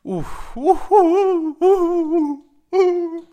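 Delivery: shrill, very high, loud oohs; a falsetto parody of dad's alarm; high mock cries. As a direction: yelp it out high like an outraged dad. Dad's reaction, wordless cries.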